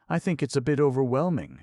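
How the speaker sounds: high, quick, lightly evasive woman's voice; non-committal. A narrator's reading. Kate replies to Mirabal's torrent, high and non-committal. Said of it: "non-committal".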